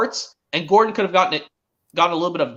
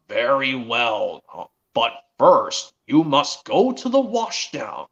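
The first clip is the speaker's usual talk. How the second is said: slow, lower voice of a boss giving orders; Sir Thomas; 5 semitones lower, slower than usual. Acted. Railway boss sending the engine to the washdown, slow and low. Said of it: "And Sir Thomas says".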